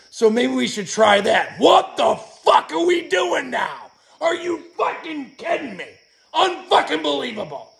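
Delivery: high, loud, outraged shouting; angry yelling. Real, not acted. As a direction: yell it out in disbelief. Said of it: "I just had to come out here and fucking yell".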